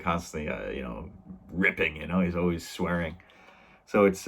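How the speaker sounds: normal talking voice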